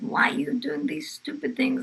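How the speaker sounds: high-pitched, mock-indignant rebuke; retold angry scolding. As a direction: scold like an outraged policewoman. Policewoman scolds grandmother over candles.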